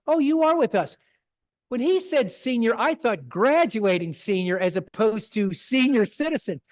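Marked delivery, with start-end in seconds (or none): 0.1-1.0 surprised realization. Retold or imitated. Photographer realizing the older lady is the senior model, surprised. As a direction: catch on all at once, recovering politely. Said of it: "And I was like".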